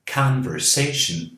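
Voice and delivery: Conversation with CON light and SA the strongest; stress on sation. Shown as a demonstration. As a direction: hit SA in Conversation hardest. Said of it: "Mas esta é a mais forte".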